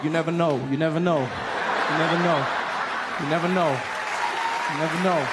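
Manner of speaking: lightly voiced Obama bouncing confidently; Obama strutting in his head. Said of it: "he's like".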